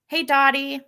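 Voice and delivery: ordinary delivery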